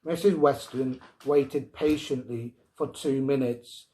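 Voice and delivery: ordinary delivery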